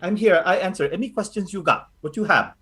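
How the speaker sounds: lightly voiced doting Filipino grandmother; a hint of a fussing lola reassuring the party; grandma Lola Carly. Lola Carly fussing over the recruits, lightly voiced.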